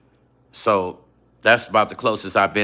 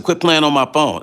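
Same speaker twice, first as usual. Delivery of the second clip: higher, mildly irritated comeback; retold annoyance; 5 semitones higher, 4 dB louder than usual. To supposed prank caller, mock annoyance. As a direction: tell off a prank caller, acted out.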